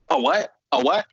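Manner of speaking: high, flustered repeated what; confused butting in. Retold or imitated.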